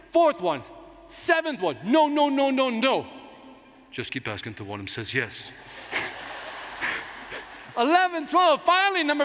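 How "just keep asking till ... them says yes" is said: put-on low, soft Spanish-accented advice; Ricardo Montalban voice. Mentor revealing the secret to success in a low Spanish accent. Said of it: "hear in my head".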